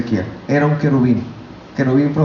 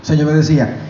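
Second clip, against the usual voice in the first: ordinary delivery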